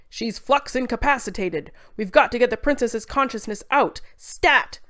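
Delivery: loud urgent medical orders; urgent shout. Acted. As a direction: bark orders in an emergency. Scientists rushing an emergency.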